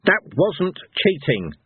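slow, high-pitched indignant growl; growled retort. A narrator's reading. Cohen growls back at the gods over cheating. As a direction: growl it slowly in protest. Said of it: "Cohen growled".

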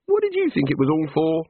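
high, bright small-god voice; cheerful little god. A narrator's reading. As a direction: play an eager little craftsman god. God character voice.

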